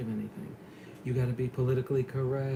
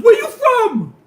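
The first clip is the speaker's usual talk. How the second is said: much higher, loud shouted question, laughing; mock yell; 18 dB louder, slower than usual. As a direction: bellow it out the car window. Voicing driver yelling at red light.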